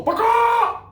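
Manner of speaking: loud, very high "ba-kaa"; chicken squawk. Shown as a demonstration. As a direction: squawk like a chicken, second syllable long. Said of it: "make a chicken beak".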